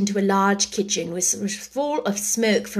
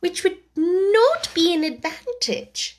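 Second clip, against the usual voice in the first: put-on high, know-it-all girl; young girl voice; 8 semitones higher than usual. A narrator's reading. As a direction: show off a little. Alice showing off knowledge.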